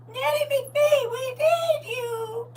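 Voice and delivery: high, slow, wailing plea for rescue; hammed-up high, slow cry for help; dramatic plea. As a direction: beg Nanny McPhee like a desperate child, drawn out. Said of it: "You said Nanny McPhee".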